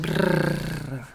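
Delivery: soft, low lip-and-tongue trill; rolled R attempt. Shown as a demonstration. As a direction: try a quiet rolling brrr. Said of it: "I can't roll my R's".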